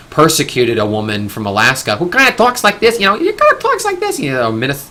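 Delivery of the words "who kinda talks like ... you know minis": put-on Alaskan accent, pitched way up; mock Alaskan accent. Mocking an Alaskan politician who talks like this, exaggerated high-pitched accent. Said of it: "she has a weird accent".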